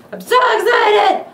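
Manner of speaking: high, loud, shouted excitement; excited shout. Real, not acted. Unboxer shouting his excitement at the camera. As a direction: yell it out in pure glee. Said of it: "If I make weird noises".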